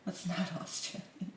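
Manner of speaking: unvoiced whisper; whispered aside. Real, not acted. Vlogger correcting himself, whispered aside.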